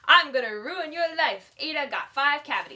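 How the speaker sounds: loud hammy villain threat; sneering panto villain. Pretend. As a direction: ham up a gleeful baddie. Mock evil threat to friend.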